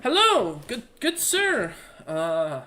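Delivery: high, drawn-out hearty greeting; lightly voiced young merchant; young gentleman shopkeeper. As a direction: greet the customer loudly, pitched well up. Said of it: "a young gentleman looks at you and goes".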